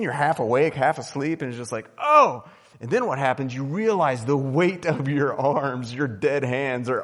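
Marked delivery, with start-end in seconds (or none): 2.0-2.4 high, loud "oh" of pain; sudden imitated yelp; mock pain yelp. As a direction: cry out as pins and needles strike. Half asleep, hit by pain.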